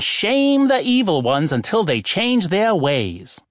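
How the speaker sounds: rally them like a self-righteous oracle; oracle preaching. Acted. Oracle preaches about evil people.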